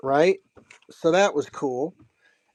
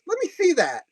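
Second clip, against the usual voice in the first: eager request; 12 semitones higher than usual. Retold or imitated. Retold eager artist.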